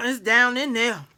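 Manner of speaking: usual speaking voice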